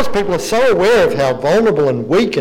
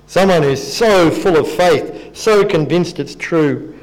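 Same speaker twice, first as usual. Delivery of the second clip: normal talking voice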